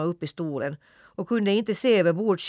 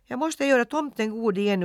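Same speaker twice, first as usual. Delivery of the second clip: higher, urgent outburst; boy suddenly exclaiming his plan; 8 semitones higher, 4 dB louder than usual. A narrator's reading. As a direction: blurt it out in alarm. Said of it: "utbrast han".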